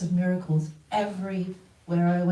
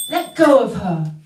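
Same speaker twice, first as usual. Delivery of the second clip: loud, sharp order from the guru; commanding shout; 2 semitones higher, 10 dB louder than usual. Retold or imitated. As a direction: stop them dead with a loud command. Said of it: "Gurudev came".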